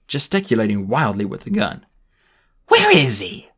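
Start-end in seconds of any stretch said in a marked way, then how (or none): 2.7-3.5 angry shout. Acted. Spiro demanding.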